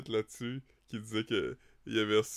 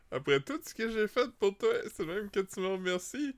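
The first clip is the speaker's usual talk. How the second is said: whiny demanding voice; 9 semitones higher than usual. Retold or imitated. Mocking a needy demand.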